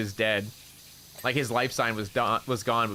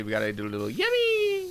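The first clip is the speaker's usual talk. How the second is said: yippee squeak; 4 semitones higher than usual. Pretend. Doing the yippee.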